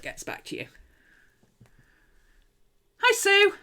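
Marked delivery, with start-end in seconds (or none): 3.0-3.5 high, loud, cheery hello; calling a greeting. Real, not acted. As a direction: call out hello to a newcomer. Greeting someone who just arrived.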